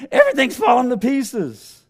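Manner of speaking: the woman crying out to Pastor Damon in distress, high and loud. Acted. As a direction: cry it out to the pastor as though it is all breaking apart.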